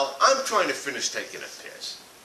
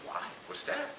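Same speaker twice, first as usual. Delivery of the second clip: drowsy question; 12 dB quieter than usual. Retold or imitated. Wife woken at 3am, drowsy.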